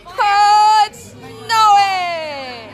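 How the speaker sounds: high, loud cry of delight; thrilled yelp; excited shout. Real, not acted. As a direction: shout it out with joy as you land in the snow. First jump into the snow.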